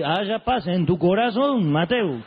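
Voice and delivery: slow, drawn-out soothing voice; forced calm. Retold or imitated. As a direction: force yourself to sound peaceful. Padre se calma a la fuerza. (el padre conteniéndose, lento y forzadamente sereno)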